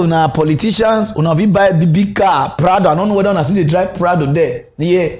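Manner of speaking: usual speaking voice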